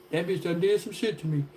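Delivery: low, soft mock grumble; lightly voiced man brooding. A scorned man grumbling about her, lightly voiced. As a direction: mutter it low like a scorned guy. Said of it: "thinking in the days like".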